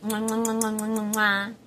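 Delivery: rapid string of mwahs, big last one; playful air kisses. Pretend. Thanking a friend on video, effusive blown kisses.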